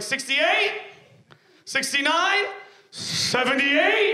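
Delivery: high, questioning call, last one stretched out; calling out numbers. Acted. Burger staff calling receipt numbers to waiting customers, teasingly drawn out.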